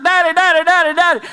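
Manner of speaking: high, loud repeated yelps; excitable high-pitched doggy voice; fired-up dog. Acted. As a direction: imitate a dog thrilled at its owner's return. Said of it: "The dog is fired up".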